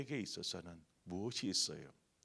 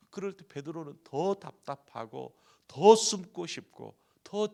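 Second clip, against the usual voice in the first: loud, high, impassioned sermon climb; preaching at full force; 5 semitones higher, 11 dB louder than usual. Acted. Preacher to congregation, on Peter's shame, preaching at full force. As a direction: preach it out, rising and pressing.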